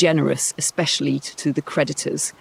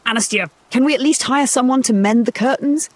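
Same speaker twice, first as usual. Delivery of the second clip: lightly voiced whiny daughter; 8 semitones higher than usual. Daughter complaining to mother.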